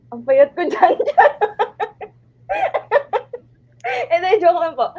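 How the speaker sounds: high, loud, laughing through the words. Real, not acted. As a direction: crack up while talking, voice jumping up. Cracks up at old photo.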